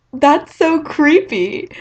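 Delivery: drawn-out playful jab; smiling while teasing her; smiling tease. Retold or imitated. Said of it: "with this smile on his face".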